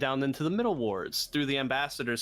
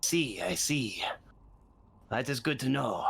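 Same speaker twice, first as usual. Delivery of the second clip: rasping voice. Acted. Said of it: "weird rasping voice".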